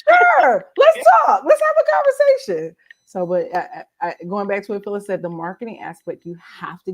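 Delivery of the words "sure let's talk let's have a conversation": very high, loud squeal; eager acceptance. Retold or imitated. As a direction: shout it in gleeful readiness. Retells eager reply to reader.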